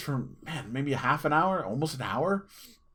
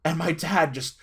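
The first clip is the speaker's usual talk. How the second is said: ordinary delivery, nothing marked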